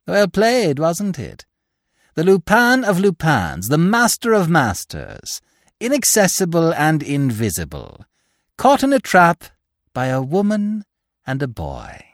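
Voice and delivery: high, exultant mockery; gloating triumph. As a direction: crow over the trapped hero.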